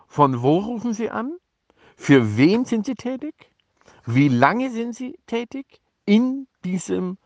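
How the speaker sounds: leicht gespielte Nachfragen, höhere Stimme; nachgeahmte Fragende. Retold or imitated. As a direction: ahme die Fragende in höherer Lage nach. Spielt lästige Fragen der Pressestelle nach. (nachgeahmte Ausfragerin, höher)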